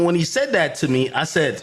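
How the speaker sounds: ordinary talk, nothing marked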